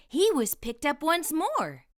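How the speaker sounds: ordinary delivery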